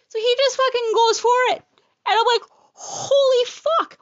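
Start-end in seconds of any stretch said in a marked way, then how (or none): 2.7-4.0 high, slow, stretched out in pain; pained exclaim. Retold or imitated. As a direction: let it drag out of you in shock. Telescope goes in, pain.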